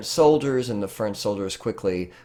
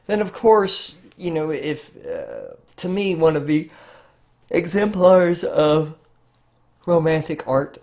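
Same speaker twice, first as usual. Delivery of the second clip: words stretched high through a yawn; talking through a real yawn mid-lecture; 8 semitones higher, 5 dB louder than usual. Real, not acted. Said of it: "pardon me, guys, for yawning".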